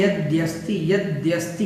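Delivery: यद्यस्ति said softly with the द्य cluster clear; careful pronunciation. Shown as a demonstration. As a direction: give यद्यस्ति its joined द and य, quietly. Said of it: "यद्यस्ति बोलना है".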